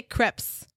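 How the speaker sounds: crepes said as "kreps"; short-vowel pronunciation. Shown as a demonstration. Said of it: "We say them differently".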